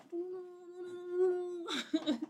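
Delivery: high, wavering wordless trill; long high put-on noise, breaking into a laugh; mock dancing noise. Mimics dancing for the gunslinger.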